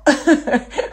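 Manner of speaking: short high burst of laughter; laughing at sister's dancing; real laugh. Real, not acted. Cracking up over Kate's dancing, a high burst of laughter.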